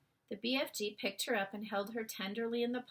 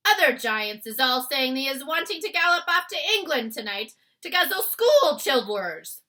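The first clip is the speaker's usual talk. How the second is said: louder, higher scolding tone; reproachful giant; 5 semitones higher, 13 dB louder than usual. Acted. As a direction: tell him off firmly.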